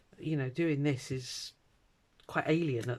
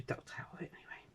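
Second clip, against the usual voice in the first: fast unvoiced whisper; 13 dB quieter, faster than usual. Real, not acted. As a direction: whisper it quickly as an aside.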